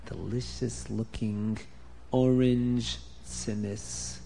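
slow, low, hushed savoring of the food. A narrator's reading. Storyteller to children, slow and savoring. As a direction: draw the words out as if tasting them.

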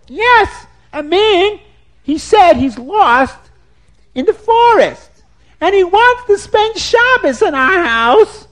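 high, loud, slow scolding woman's voice; shrill woodcutter's wife. Acted. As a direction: shoo the stranger off in a high, loud voice. Woman voice in told story.

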